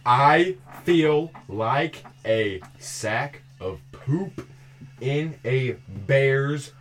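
slow and deliberate. Shown as a demonstration. Mouthing slowly for lip reader.

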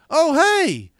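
high, loud imitated greeting; delighted recognition. Commentator recognizing the fan who emailed him, delighted. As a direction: burst out as if spotting someone you know.

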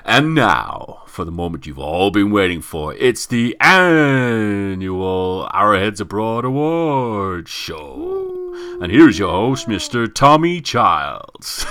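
big, loud, drawn-out game show host announcement; announcer voice. Shown as a demonstration. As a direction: stretch every word like a TV awards show announcer. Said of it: "get my announcer voice on for this".

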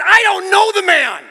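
high, drawn-out furious shout; adamant, freaking out. Retold or imitated. Peter swearing he doesn't know Jesus, freaking-out shout. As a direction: bellow the denial at full force, slowly. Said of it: "Peter swore and cursed".

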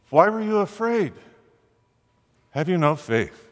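very high, searching "Why were you afraid?"; Jesus challenging the frightened disciples, pitched high; gently rebuking question. Acted. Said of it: "He asked the disciples".

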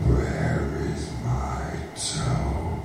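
very low, slow, soft groan; groaning ghost voice. A narrator's reading. Ghost beside the boy's bed, low soft groan. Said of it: "the voice groaned".

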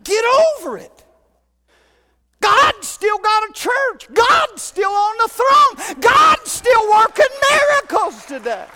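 loud, high, shouted revival preaching; preaching at full force. Acted. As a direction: fire up the church.

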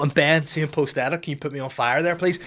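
usual speaking voice

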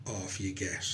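low, soft, lightly acted weariness; worn-out crane. Tired crane telling Danny to get off, wearily. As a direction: give up slowly and quietly, as if spent. Exhausted crane dumping its passenger mid-sea.

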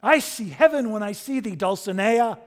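high, loud, rapturous declamation; ringing lovestruck knight, spoken grandly; Quixote. Acted. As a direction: proclaim adoration to the heavens. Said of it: "sings Quixote".

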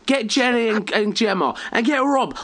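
high, eager rounding-up voice; mock northern partygoer. Parodying an office partier wanting a photo, high and eager.

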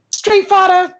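high, punchy title call; game title announcer. Acted. Comic revealing her spoof game to the audience, game announcer voice.

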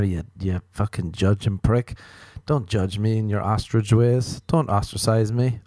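ordinary talk

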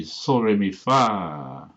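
sol, re, mi, then a long held FA; lengthened last syllable. Shown as a demonstration. Showing the plural songs, FA lengthened. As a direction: draw out the final fa. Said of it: "Lengthen the last syllable".

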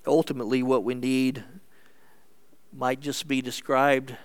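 ordinary talk

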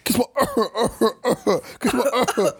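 giggling through the words; mock girly line cracking up; laughing. Imitating girls, laughing.

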